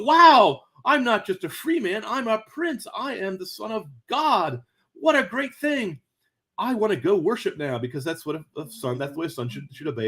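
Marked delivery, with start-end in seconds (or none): 0.0-7.8 high, excited, awestruck rush of joy; thrilled to discover he is royalty; overjoyed prince. Retold or imitated. Imagined new prince's delight.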